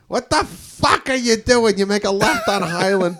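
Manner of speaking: mock yelling. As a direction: snap at the driver like a furious wife. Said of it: "but it's like".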